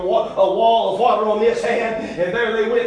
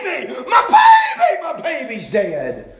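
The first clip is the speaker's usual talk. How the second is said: anguished scream; 7 semitones higher than usual. Acted. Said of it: "screaming out".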